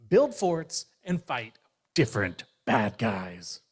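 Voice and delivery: a new toy hamming up his sales pitch; eager toy bear. Acted. Bear greets his new owner, eager and proud.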